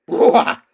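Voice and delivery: loud unvoiced horse snort. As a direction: blast air like a stallion. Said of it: "he snorted".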